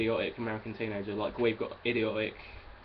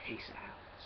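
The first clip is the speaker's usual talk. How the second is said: whispered sign-off; 13 dB quieter than usual. Real, not acted. Signing off the video.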